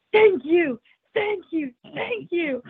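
high, overwhelmed thank-yous to the sky; tearful thanks. Retold or imitated. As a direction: thank God through happy tears. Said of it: "and I'm crying, and I'm smiling".

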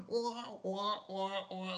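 imitated cartoonish villain cackle, soft and high; mock a terrible villain laugh in slow wahs; hammed-up "wah wah" laugh; Joker laugh. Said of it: "his laugh".

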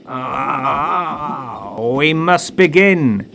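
a drawn-out medium's cry; cried out. Acted. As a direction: cry it out as the spirit takes hold. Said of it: "she cried".

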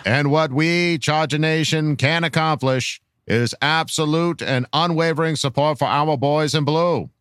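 put-on measured, old-fashioned presidential speech delivery; grand political orator. Reading a fan's rallying question to Charger Nation, grand oratory. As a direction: deliver a solemn, rousing address with dropped R's.

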